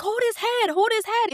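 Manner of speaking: high, insistent mimicked nurse; imitated urgent orders. Nurses instructing a struggling new mother, imitated urgent orders.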